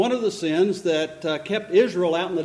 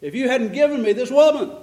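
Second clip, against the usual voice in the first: higher, louder accusing protest; complaining; 6 semitones higher, 5 dB louder than usual. Acted. Adam blaming God for the woman, complaining loudly. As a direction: protest and shift the blame upward.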